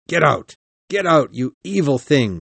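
high, loud, furious order; screamed. A narrator's reading. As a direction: scream at the monster to drive him away. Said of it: "I screamed".

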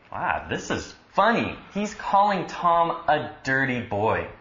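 ordinary talk

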